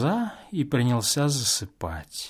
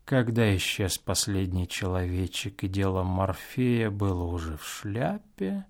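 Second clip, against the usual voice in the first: ordinary delivery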